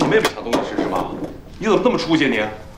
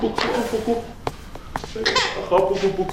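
轻哄; 10 semitones higher, 4 dB quieter than usual. Acted. 轻声哄婴儿.

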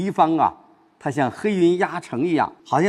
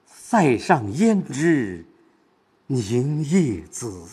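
凝夜紫 drawn out very slowly after a pause; inward stress; much slower than usual. Shown as a demonstration. Teacher showing tragic weight, slow inward stress on 凝夜紫. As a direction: weigh down 凝夜紫, turned inward, heavy with war's cruelty. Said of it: "它是往心里走".

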